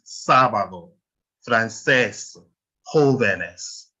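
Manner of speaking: SÁ in sábado, CÉS in francés, JÓ in jóvenes stressed; stress on accented vowel. Shown as a demonstration. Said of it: "you stress that letter".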